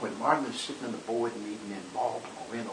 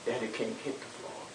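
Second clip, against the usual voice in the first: ordinary delivery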